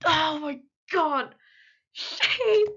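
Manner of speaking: high, drawn-out wail of dismay; distressed fan outcry. Retold or imitated. Fan reliving seeing a character get hurt, anguished outcry. Wailed reaction to character's injury.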